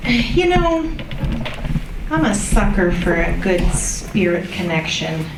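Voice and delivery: usual speaking voice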